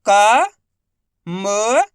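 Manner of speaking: "का", then "म", each held alone with a clear pause; syllable by syllable. Shown as a demonstration. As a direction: sound out "का" and "म" separately and loudly. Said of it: "एक एक अक्षर का उच्चारण करके".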